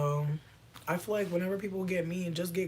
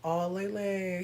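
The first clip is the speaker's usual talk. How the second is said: ordinary talk, nothing marked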